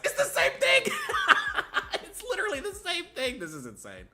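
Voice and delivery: high, laughing disbelief; laughing realization. Real, not acted. Streamer laughing at the [name] parallel, high pitched. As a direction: laugh it out in shock.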